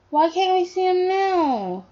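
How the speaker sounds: put-on high, drawn-out little kid question; slow, high-pitched young son; child's voice. Son asking parent why, in a high child's voice.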